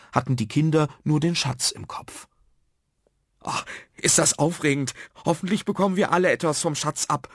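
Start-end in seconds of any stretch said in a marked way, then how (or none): 3.4-7.3 put-on high, excited child, speeding up; eager anticipation. Alex awaits the treasure, excited. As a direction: bubble over with high-pitched anticipation. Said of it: "hüpfte vor Vorfreude herum".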